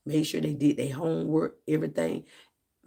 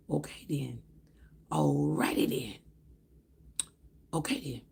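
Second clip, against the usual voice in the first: softer, part whispered okay then; muttered aside; 3 semitones lower, 6 dB quieter than usual. Retold or imitated. Speaker walking away from host, quiet muttering. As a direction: mutter it to yourself, put off. Muttering to self after snub.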